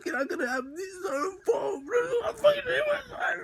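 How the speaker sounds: high, loud wailing sobs through the words; over-the-top crying, words stretched into howls; hammed-up bawling. Storyteller acting out crying over the girl he lost, hammed-up bawling. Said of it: "I got right into character".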